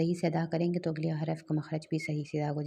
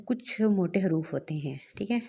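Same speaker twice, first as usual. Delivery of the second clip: ordinary talk, nothing marked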